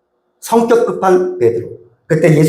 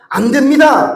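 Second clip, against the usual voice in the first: higher, decisive refusal; firm; 8 semitones higher, slower than usual. Shown as a demonstration. Pastor contrasting comfort with a firm refusal, decisive. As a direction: say it short and final. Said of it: "라고 단호하게 얘기할 때".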